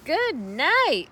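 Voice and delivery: high, loud disgusted scream. A narrator's reading. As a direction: yelp at the stink. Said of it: "screamed Avery".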